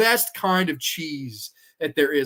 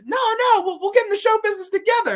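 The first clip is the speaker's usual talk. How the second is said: pleading creature; 4 dB louder than usual. Retold or imitated. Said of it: "and he's".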